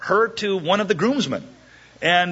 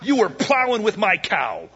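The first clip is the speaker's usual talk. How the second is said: mild mock anger; 6 semitones higher than usual. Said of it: "he says this classic line".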